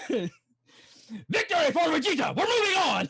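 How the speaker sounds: high, loud victory cry; hammed-up anime battle yell; triumphant shout. Player voicing an anime victory, high triumphant shout.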